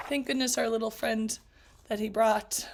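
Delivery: plain reading voice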